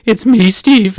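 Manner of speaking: high, loud, drawn-out greeting; mock heartthrob introducing himself in a squeaky-high loud voice; exaggerated high-pitched show-off hello; super handsome guy. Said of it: "super handsome guy, he could go on there".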